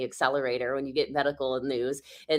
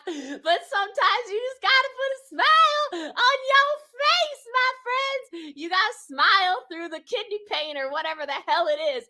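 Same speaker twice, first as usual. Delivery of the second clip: forced cheerfulness; 6 dB louder than usual. Acted. Forced cheerful vlogger talk.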